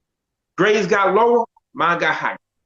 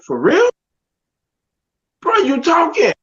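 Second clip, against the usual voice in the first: high, stunned disbelief; can't believe his own ears; pitched-up amazed for real; 9 semitones higher, 3 dB louder, slower than usual. Retold or imitated. Stunned at own normal speech.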